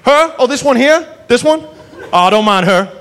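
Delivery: high, breezy Southern brush-off; imitating an unbothered lawman; nonchalant Southern cop. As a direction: wave the trouble away like it's nothing. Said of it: "And he goes".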